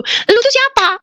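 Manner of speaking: very high, drawn-out demand; indignant challenge. Retold or imitated. Woman demanding who the voice is, indignant.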